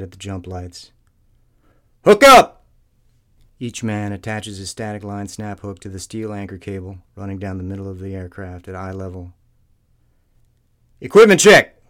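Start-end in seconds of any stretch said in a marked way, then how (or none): 2.0-2.7 high, loud bellow over engines; jumpmaster barking cues. A narrator's reading. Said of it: "Dick Pole's voice suddenly fills the tube". 11.0-11.8 high, loud bellow over engines; jumpmaster barking cues. A narrator's reading. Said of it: "Dick Pole's voice suddenly fills the tube".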